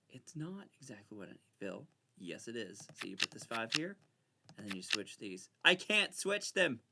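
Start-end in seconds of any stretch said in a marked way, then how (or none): 5.6-6.8 frustrated. Real, not acted. Frustrated at the stuck game.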